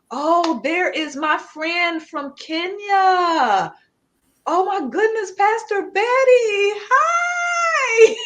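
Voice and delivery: light up and cry out hello, half laughing; host thrilled to see Pastor Betty join from Kenya, squealing; delighted greeting. Real, not acted. Host spots friend from Kenya online.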